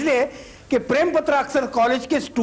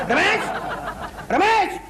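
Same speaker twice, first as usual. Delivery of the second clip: high-pitched summons to an assistant; calling out; 9 semitones higher, slower than usual. Acted. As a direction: holler the name with a rising end. Boss calls out for assistant.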